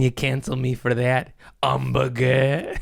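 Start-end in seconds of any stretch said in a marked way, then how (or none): 1.6-2.7 put-on slow French-accented "Hamburger" with the H dropped; mock French accent. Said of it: "They don't say H's".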